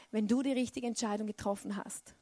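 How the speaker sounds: ordinary delivery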